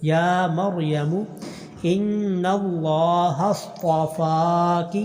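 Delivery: slow drawn-out tajweed chant; slow tarteel recitation; Quran recitation. Shown as a demonstration. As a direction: recite each vowel long and measured. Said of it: "لفظ اللہ کو لمبا کرنا".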